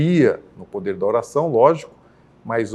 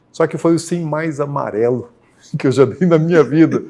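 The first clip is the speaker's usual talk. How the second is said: amused chuckle while telling; 3 semitones higher, 3 dB louder than usual. Real, not acted. Amused at his own timid yes.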